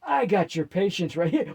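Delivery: loud, defiant retort; defiant snap. Acted. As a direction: throw it back at her loudly. Demon snaps at Alina.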